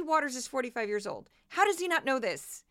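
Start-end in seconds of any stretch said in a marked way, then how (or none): none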